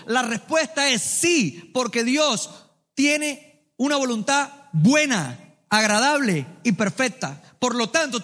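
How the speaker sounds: ordinary delivery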